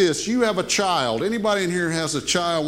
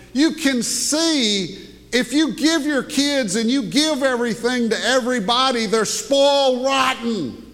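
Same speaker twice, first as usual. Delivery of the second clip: rising emphasis; 6 semitones higher than usual. Real, not acted. Preaching emphasis.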